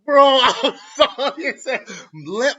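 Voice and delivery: high, slow, laughing through the words. Real, not acted. Vlogger cracking up over lemon and melon, laughing through words. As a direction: crack up mid-sentence, can't hold it in. Laughing at his own discovery.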